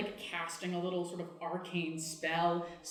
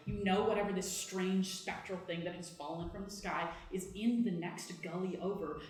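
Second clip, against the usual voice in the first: usual speaking voice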